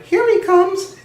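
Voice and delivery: put-on high loud child's shout; boy shouting. A narrator's reading. Little boy spotting the guard, loud high shout. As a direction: call out like an excited little boy. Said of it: "a little boy shouted".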